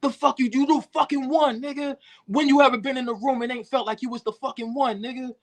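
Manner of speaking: imitated tirade, pitched far up; retold angry screaming. Rapper imitating his friend refusing to let him quit, angry retold yelling. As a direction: berate him in a high, indignant voice. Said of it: "him screaming on me like".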